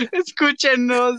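high slow begging whine; pleading. Pretend. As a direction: drag out a mock plea. Echoes begging again, slower.

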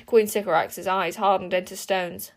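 ordinary delivery, nothing marked

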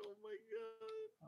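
high, slow stunned gasp; drawn-out disbelief; shocked. Retold or imitated. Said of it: "He's like".

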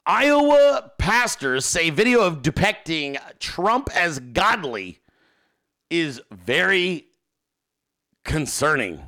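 slow dramatic headline read. Real, not acted. Reading a New York Times headline to viewers, slow dramatic. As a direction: read the headline slowly for effect. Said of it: "You see that?".

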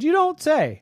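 high, loud playful disbelief; mock surprise. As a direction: tease like you're amazed. Vet answers dog.

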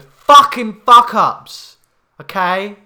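bellowed, drawn-out joke; loud slow shout. Acted. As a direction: yell it out slowly, proudly. Shouted self-introduction joke.

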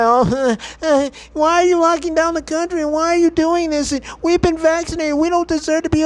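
imitating whining Americans in a high, nasal-free sneer; whiny complainer. Vlogger mocks lockdown complainers with a high whiny voice. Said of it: "bitch and complain and whine and cry".

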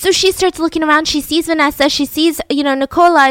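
ordinary delivery

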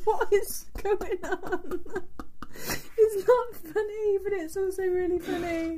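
high, drawn-out words through laughter; real helpless giggling protest; trying not to laugh. Real, not acted. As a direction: squeak it out while cracking up. Cracking up at the story.

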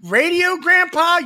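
put-on high, loud little-kid questions; mock kid voice. Mocking a grandkid, a high childish question. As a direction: play a kid asking grandpa.

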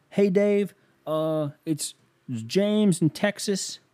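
high, hesitant phone-in caller; hapless caller. Retold or imitated. Caller phoning the money show, sheepish voice.

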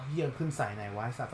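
soft, cursing under the breath; mock muttering. Friend imitating someone's muttered self-talk. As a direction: grumble it quietly to yourself. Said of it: "แค่แบบอารมณ์แบบว่า".

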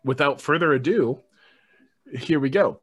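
normal talking voice

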